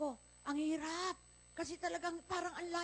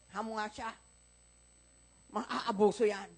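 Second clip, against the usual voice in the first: normal talking voice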